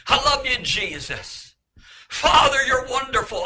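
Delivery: retold scream. Man screaming praise to God from the pit of hell, retold scream. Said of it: "I'd scream".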